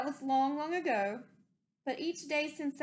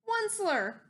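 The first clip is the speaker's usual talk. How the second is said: high, loud croaked cry of the name; a croaky high call; croaking cry; 9 semitones higher, 3 dB louder than usual. Acted. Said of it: "he cried with a crofulous croak".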